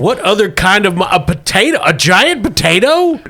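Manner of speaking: high, mounting disbelief; incredulous. Acted. Boss hears agent suggest a potato monster, incredulous. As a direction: question it as utterly absurd.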